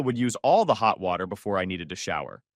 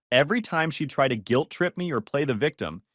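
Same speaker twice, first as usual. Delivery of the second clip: ordinary delivery, nothing marked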